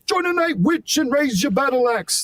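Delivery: cartoon-high rock shout-talk. Shown as a demonstration. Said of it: "[name] kind of thing".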